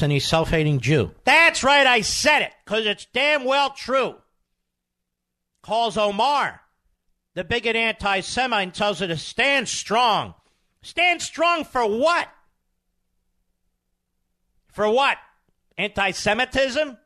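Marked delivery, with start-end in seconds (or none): 1.2-4.2 defiant outburst. Real, not acted. Host defiantly doubles down on air. 10.8-17.0 very high, slow, drawn-out outraged questions; high, slow scornful questions; indignant scoffing. Real, not acted. Host mocks with indignant questions.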